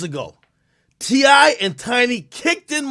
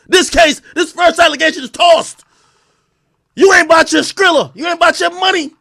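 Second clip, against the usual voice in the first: loud, higher mock courtroom ruling, half shouting; mock judge; 5 semitones higher, 5 dB louder than usual. Streamer playing judge rants at the accuser, loud and mocking. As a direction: bang the gavel and rant at the accuser. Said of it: "Judge Academics is presiding".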